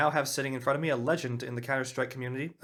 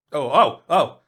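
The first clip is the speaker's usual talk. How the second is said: louder burst of oh oh; catching himself with glee; loud excited oh oh oh; sudden exclamation; 5 semitones higher, 14 dB louder, much slower than usual. Real, not acted.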